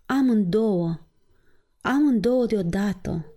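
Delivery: understated grieving outcry; saddened exclamation. A narrator's reading. Father laments losing both daughters at once, mildly saddened. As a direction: let a touch of grief into the exclamation. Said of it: "exclamă el cu glas întristat".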